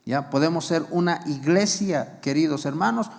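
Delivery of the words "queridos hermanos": usual speaking voice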